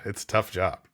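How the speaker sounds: ordinary delivery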